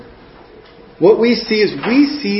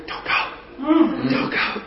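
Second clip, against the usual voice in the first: breathless whispered don't go; clinging to the boat, whispering in fear; frightened whisper; 8 semitones higher, 8 dB quieter than usual. Retold or imitated. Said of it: "wide-eyed fear".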